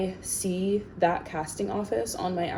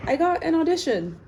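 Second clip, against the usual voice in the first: mocking quote; 9 semitones higher, 4 dB louder than usual. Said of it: "I can't pay my bills with".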